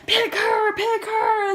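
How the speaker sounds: high, pleading chant; eager friend. Retold or imitated. Kim begging the idol to pick her friend, high and eager. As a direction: beg him to choose her friend.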